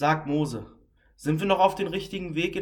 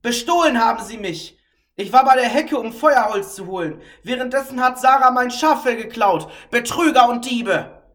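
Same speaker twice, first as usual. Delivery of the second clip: loud scolding; 6 semitones higher, 8 dB louder than usual. Acted. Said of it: "laut schimpfend".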